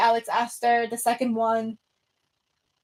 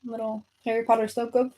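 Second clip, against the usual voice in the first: normal talking voice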